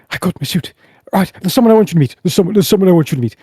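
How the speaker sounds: high, rapid, bustling chatter; eager excitement. Acted. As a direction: rush it out, keen to introduce someone. Tame eagerly fetches the party.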